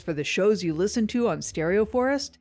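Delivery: loud, bright promo voice; ad read. Acted. Network ad read inviting listeners to subscribe. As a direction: sell the newsletter like a radio spot.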